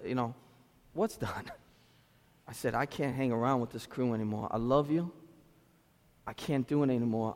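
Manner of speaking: quiet, heavy farewell. Retold or imitated. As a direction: break it to your friend gently. Tender, hard talk with friend.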